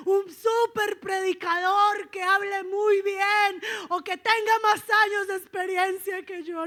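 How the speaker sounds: high, loud, fervent exhortation; building, shouted revival-sermon delivery; full-force preaching. Real, not acted. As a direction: drive each phrase home to the congregation.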